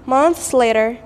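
ordinary delivery, nothing marked

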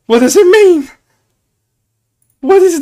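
high, loud squeak of confusion; baffled. Real, not acted. Streamer squeals at a baffling emote, high and loud. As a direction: pitch way up in disbelief.

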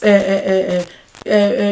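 normal talking voice

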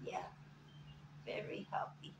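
breathy whisper to the pet; hushed whisper. Real, not acted. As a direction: whisper it close and tender. Cooing over massaged bird.

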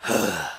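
imitated heavy exhale; big sigh. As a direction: let out a judging cashier's big breath. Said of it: "as she breathes, this big".

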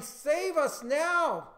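high, loud, slow drawn-out cry; crowd shouting. Acted. Crowd crying out to Jesus, long high shout. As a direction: stretch each word into a big shout. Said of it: "They were shouting".

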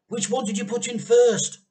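high, loud eager question; bursting to know; eager dad. A narrator's reading. Mister Cranky quizzing George at the pan, eager and loud.

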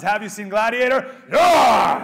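loud call and response, shouted back; rally the crowd with a big yell; call-and-response shout, full volume; enthusiastic shout. Shown as a demonstration. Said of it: "The proper response is".

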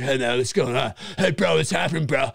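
tough bro voice. Retold or imitated. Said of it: "a guy who's like".

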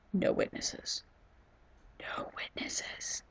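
breathy villain whisper, fading to nothing; creepy whisper. Pretend. Gamer whispering no witnesses to the pig, creepy whisper. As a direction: hiss it like a sneaky killer.

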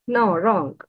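ordinary talk

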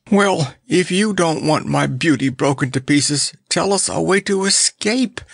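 high, urgent plea; begging. A narrator's reading. Clown nervous by the island's edge, begging. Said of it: "begged the clown".

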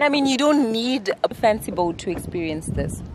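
normal talking voice

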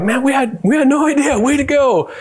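high-pitched retold amazement; amazed cheering. Parents congratulating their daughter after the race, amazed. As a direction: imitate thrilled parents, voice lifted well up.

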